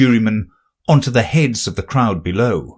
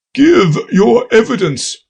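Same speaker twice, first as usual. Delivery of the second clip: high, louder king command; 12 semitones higher, 4 dB louder than usual. A narrator's reading. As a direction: demand it like a king. The King voiced.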